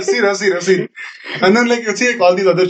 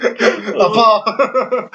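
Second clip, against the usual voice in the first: put-on laughing Indian-accent "Appa"; "Appa" through laughter, Indian accent; 3 semitones higher than usual. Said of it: "in an Indian voice".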